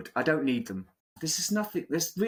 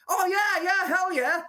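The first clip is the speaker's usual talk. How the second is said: high, loud fake enthusiasm; eager plant in crowd; 4 dB louder than usual. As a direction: hype it up as a paid shill. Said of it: "who's actually working for them goes".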